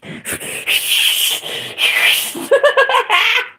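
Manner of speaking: long breathy high-pitched laugh. Real, not acted. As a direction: laugh at your own rant. Laughing at own rant.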